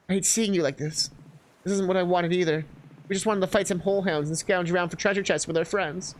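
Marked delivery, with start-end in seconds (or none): none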